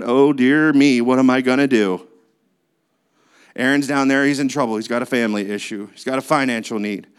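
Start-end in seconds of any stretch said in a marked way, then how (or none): 0.0-2.1 mock worried, pacing voice; hammed-up fretting, lower and louder; mock panic over a problem; fretting God. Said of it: "pacing the floor going".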